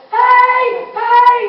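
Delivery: high, loud yells to flag the truck; calling out. Retold or imitated. Bystanders flagging the fire truck, high loud calls. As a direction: shout to wave them down.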